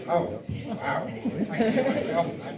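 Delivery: startled laugh at his own loud voice; laughing surprise. Real, not acted. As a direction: laugh as the mic suddenly booms. Mic suddenly picks up speaker.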